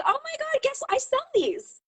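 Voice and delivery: high, gushing retold sales pitch; excited retelling. Seller telling an admiring store worker she sells these, excitedly high. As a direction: squeal it lightly, re-enacting your excitement.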